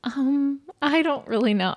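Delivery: high-pitched, hesitant hedging; imitated reluctance. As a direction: mimic a friend reluctantly hedging, voice lifted high. Retelling friend's reluctant phone answer.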